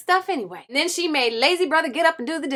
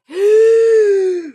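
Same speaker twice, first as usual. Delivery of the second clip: big huff; 7 semitones higher, 9 dB louder than usual. Acted. Wolf huffing hard.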